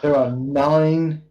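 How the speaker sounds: slow, weighty reveal; slow dramatic. Acted. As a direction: draw it out for drama. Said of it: "GM slow dramatic reveal".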